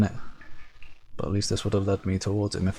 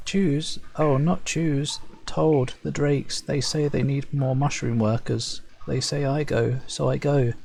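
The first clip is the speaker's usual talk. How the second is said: meek servant, a bit higher, resigned; timid Servile farmer; 5 semitones higher, slower than usual. Acted. Servile farmer telling the Shaper he was ordered, meek and resigned. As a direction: explain the orders meekly.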